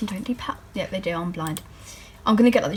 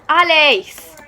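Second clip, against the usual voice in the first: loud call across the house; calling someone in another room; 5 semitones higher, 8 dB louder than usual. Real, not acted. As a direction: shout a name to get attention. Calling out to her sister.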